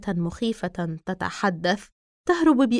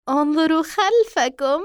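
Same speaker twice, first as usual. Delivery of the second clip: higher, louder sinister doll; creepy doll voice; 6 semitones higher, 5 dB louder than usual. Acted. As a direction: tease them like an evil toy. Doll appears behind them.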